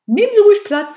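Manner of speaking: very high, loud, chirpy invitation; gleeful hostess. A narrator's reading. Käthe offers the burglar a seat, gleefully. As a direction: pipe it up with relish.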